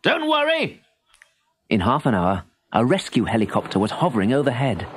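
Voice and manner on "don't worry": high hearty call down; calm the boy from above; reassuring call. A narrator's reading. The dog's owner calling down reassuringly. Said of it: "shouted the man".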